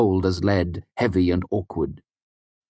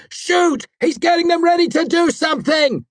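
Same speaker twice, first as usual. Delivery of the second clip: frantic shout; 5 dB louder than usual. A narrator's reading. Frantic urging continues.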